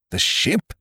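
taken aback by the news; doctor astonished to hear of the ship; exclaiming. A narrator's reading. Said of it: "exclaimed the doctor".

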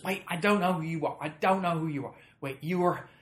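high, loud mock excitement; imitated flustered fan. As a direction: play a starstruck woman trying to place a face. Flustered fan trying to place celebrity.

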